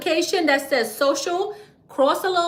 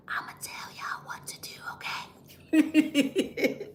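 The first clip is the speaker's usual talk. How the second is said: very soft conspiratorial whisper; 2 semitones higher, 10 dB quieter, much slower than usual. Real, not acted. Host promising followers what to do, whispered on the quiet. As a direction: whisper it, just for insiders. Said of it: "my whispering".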